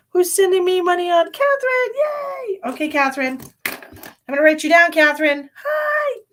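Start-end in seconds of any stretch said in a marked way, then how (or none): 0.1-2.6 much higher and louder; a thrilled call-out of thanks; delighted squeal at the donor; excited shout. Real, not acted. 5.5-6.2 much higher, a tiny squeal; a cute chirped hello; high-pitched playful hi; squeaky hi. Real, not acted. Squeaky greeting.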